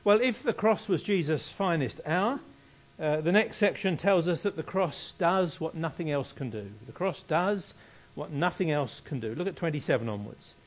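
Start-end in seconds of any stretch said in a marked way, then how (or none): none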